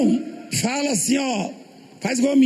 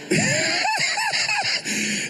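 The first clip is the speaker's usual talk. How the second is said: big laugh; 5 dB quieter than usual. Real, not acted. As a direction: crack up laughing. Laughing at own joke.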